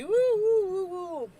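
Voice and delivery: high, loud woo woo; excited whoops. Real, not acted. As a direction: hype the good energy. Positive energy cheer.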